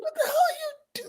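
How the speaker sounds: high squeal through laughter; cry-laugh in disbelief. Retold or imitated. Friend retells the mix-up while laughing, high and squealing.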